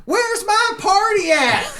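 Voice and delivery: put-on very high, drawn-out old-lady holler; hollered from afar. Great-aunt hollering from the front of the store, imitated holler. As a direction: imitate a crazy old aunt calling across the whole store. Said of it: "and I hear".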